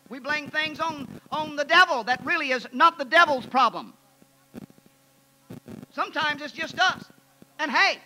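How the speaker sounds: loud, high, driving pulpit voice; full-force preaching. Acted. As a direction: preach it out to the whole congregation.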